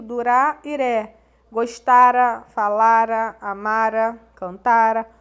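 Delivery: teacher spelling out endings with heavy stress. Shown as a demonstration. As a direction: punch each verb ending loud and clear. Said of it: "reconhecido pela presença do".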